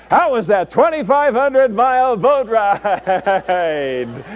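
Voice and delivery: high, loud, drawn-out comic question; the Lord teasing Jonah. Acted. God asking the runaway Jonah about his trip, high teasing voice. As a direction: stretch it out high as a playful tease. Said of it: "and he says".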